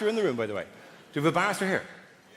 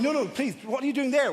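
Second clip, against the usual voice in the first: high pleading protest; mock exasperated presenter; 7 semitones higher than usual. TV expert objecting to the builders' plan, mock pleading. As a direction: plead in a raised pitch, mimicking exasperation. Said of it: "she's going".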